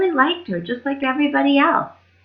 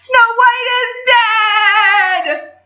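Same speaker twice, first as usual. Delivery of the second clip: high, loud, slow wail; sing-song mourning cry; high slow sung wail; wailing lament; 13 semitones higher, 4 dB louder, much slower than usual. Acted. Dwarfs wailing.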